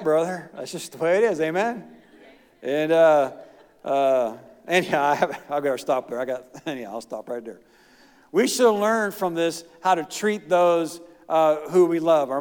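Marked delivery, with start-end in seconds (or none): none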